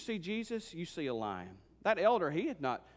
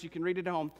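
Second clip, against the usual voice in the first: ordinary talk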